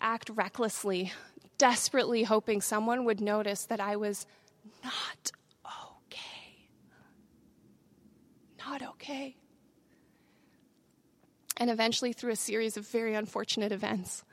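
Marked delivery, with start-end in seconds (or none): 4.8-6.7 slow, hushed whisper; whispered confession. Retold or imitated. Speaker recalls secretly hoping someone would notice, whispered. Quiet admission while hiding her distress. 8.7-9.4 very soft, almost swallowed; hushed admission. Retold or imitated. Speaker recalls hiding how bad she was, hushed.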